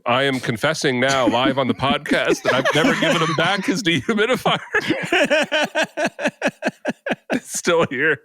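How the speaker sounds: laughing through the words; giggling while confessing. Real, not acted. Confessing he never returned it.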